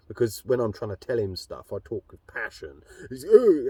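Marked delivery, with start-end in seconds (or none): 3.2-3.7 high loud startled "Oh"; hammed-up shocked "Oh"; mock startled gasp. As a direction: jump like you're frightened. Mimicking people freaking out.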